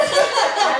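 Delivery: short high laugh; quick burst of high laughter. Real, not acted. Friend reacting to a pun, short high laugh.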